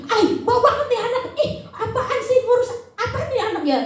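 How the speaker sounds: uncaring parent grossed out by the diaper; disgusted parent. Retold or imitated. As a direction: complain at a smelly diaper.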